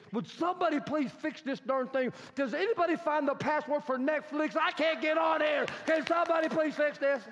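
exasperated tantrum. Acted. Viewer to household about broken Netflix, exasperated. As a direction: build to an indignant shout.

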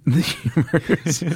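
high, slow, laughing. Real, not acted. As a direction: laugh the words out. Laughing at own joke.

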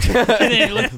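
ordinary talk

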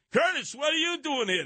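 high, startled call-out; mock surprised greeting. Drunk Kennedys greeting the host, high and startled.

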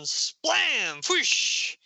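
high, punchy imitated impact and rush; spoken crash trailing into an airy whoosh; comic sound effects. Narrator voicing a sudden blast, high comic sound effects.

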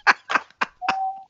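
very high helpless laugh; real high-pitched wheezy laughing fit. Real, not acted. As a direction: crack up at your own story. Cracks up at own story.

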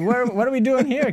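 high, baffled voice; confused. Retold or imitated. Retold confused reaction.

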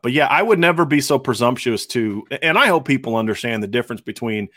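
ordinary talk, nothing marked

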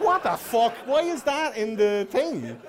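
high, loud, slow disbelief; incredulous. Retold or imitated. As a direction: shout it in total disbelief. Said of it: "and you're like".

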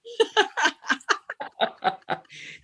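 high, bursting laugh; big laugh. Real, not acted. Laughing at own funny story.

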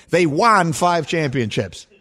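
slow mock quote with "won" rhyming with John; imitated odd pronunciation. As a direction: mimic "won" as wahn, slowly. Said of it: "I've never heard anyone say".

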